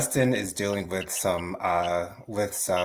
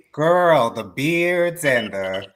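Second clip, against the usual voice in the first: excited gushing; 4 semitones higher, 4 dB louder, slower than usual. Real, not acted. Gushing about Philly men.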